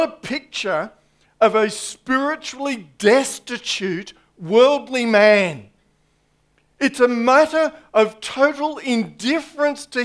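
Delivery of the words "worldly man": ordinary talk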